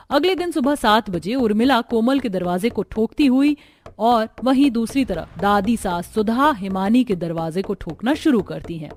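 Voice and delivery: ordinary delivery, nothing marked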